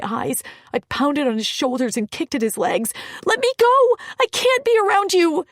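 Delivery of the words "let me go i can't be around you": loud, high, frantic cries to be let go; desperate yelling. Acted. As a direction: shout it out, thrashing and pleading. Struggling, pinned down, in tears.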